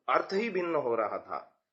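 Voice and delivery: ordinary delivery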